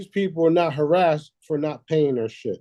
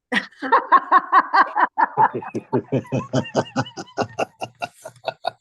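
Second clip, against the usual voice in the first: long, high-pitched burst of laughter; real laughter. Real, not acted. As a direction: laugh long and high at your own warning. Laughing at own warning.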